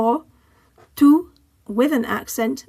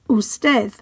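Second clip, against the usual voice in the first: Usted with STED stressed; final-syllable stress; 3 dB louder than usual. Shown as a demonstration. Teacher modelling the consonant rule, Usted stressed at the end. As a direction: say Usted in Spanish, us-TED. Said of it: "stress falls on the final syllable".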